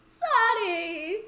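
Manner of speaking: high, loud, rising joyful cry of the name; excited call. Acted. Friend finding lost Sunny, joyful loud call. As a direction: shout her name with relief and joy. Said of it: "Oh, that was a sad Sunny".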